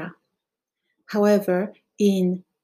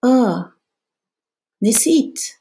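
casual Arabic aside; 4 semitones higher, 5 dB louder than usual. Shown as a demonstration. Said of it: "you could say".

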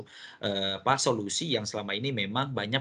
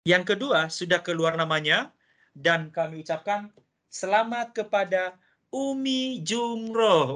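upbeat, higher-pitched prize call; booming prize announcer; 8 semitones higher, 5 dB louder than usual. Acted. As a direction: announce it like a game show host. Host reveals second winner.

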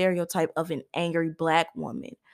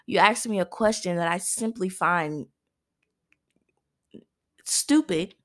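stupid tone. Shown as a demonstration. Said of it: "answering it with a stupid tone".

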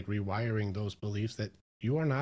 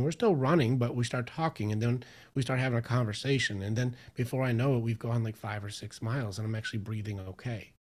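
normal talking voice

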